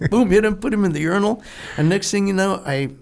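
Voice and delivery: ordinary talk, nothing marked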